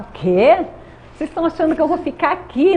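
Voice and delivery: retold indignant "what?", then a quick protest; incredulous scoff. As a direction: act out your old defiant self. Recalls scoffing at church members.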